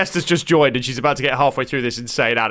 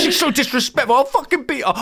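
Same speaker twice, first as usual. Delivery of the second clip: higher, lightly voiced gleeful threat; cheery threat; 10 semitones higher than usual. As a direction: imitate her sunny menace. Voicing the tube woman's threat.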